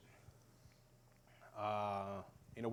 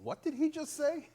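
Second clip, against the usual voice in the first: high, bewildered mock question; baffled coworkers; 14 semitones higher than usual. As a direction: play the friend who can't follow a word. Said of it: "they're like".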